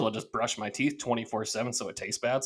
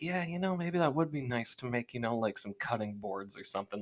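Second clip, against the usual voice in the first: quiet, half-swallowed musing to himself; mumbled to himself; 7 dB quieter than usual. Retold or imitated. Man talking himself into a hobby, murmured quietly. Quoting own hesitant inner thoughts.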